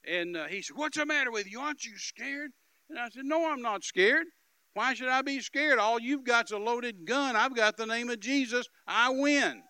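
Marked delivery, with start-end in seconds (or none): 0.8-2.5 higher-pitched retold taunt; raised voice, challenging questions. Gunman taunting his captive. 3.2-9.6 high, loud retold defiance; triumphant, forceful preacher's comeback; defiant. Defiant answer to the gunman.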